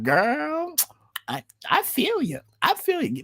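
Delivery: very high, put-on sisterly voice; mocking girlfriend voice. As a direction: parody a politician cooing "girl, I feel you". Mocking Kamala talking to Taraji.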